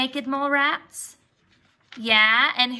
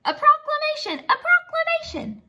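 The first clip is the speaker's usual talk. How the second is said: much higher, squeaky excited echo; excited Wilbur; 3 dB louder than usual. Acted. Wilbur repeating Grandpa's news in a high excited voice. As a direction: chant it like a thrilled little kid.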